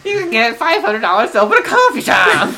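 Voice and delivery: loud, high pitchman yell; infomercial pitchman. Retold or imitated. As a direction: sell it like a crazed TV huckster. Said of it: "That's a great impression".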